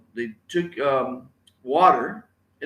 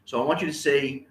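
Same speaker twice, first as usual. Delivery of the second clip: usual speaking voice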